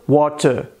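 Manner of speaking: "water" with crisp T and silent R, British; British accent. Shown as a demonstration. Teacher comparing three accents, British accent.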